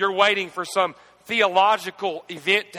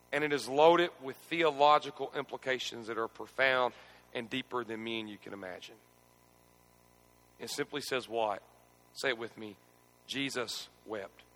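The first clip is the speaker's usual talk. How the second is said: hushed solemn; 7 semitones lower, 7 dB quieter than usual. Acted. Preacher leads congregation in the shortest verse, hushed. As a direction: drop to a near hush for the verse.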